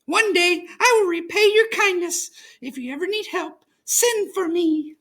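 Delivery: high, squeaky little mouse; a small grateful mouse voice; relieved little mouse squeak; tiny mouse. A narrator's reading.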